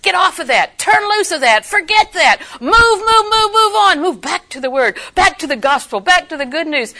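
high, loud insistent urging; urgent command. Retold or imitated. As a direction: push them to move on, loudly. God's urgent command, voiced.

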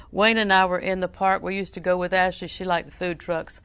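usual speaking voice

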